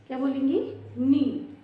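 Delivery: usual speaking voice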